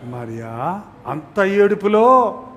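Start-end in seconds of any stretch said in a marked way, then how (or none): 0.0-0.8 soft, quiet calling of a name; gently. Shown as a demonstration. Jesus calling a weeping Mary by name, softly. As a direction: say her name softly and tenderly. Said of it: "ఎలా అన్నాడు?".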